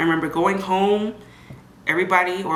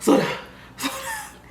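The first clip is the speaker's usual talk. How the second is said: breathless plea. Retold or imitated. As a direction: whisper in a panic from the wheelchair. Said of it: "I was like".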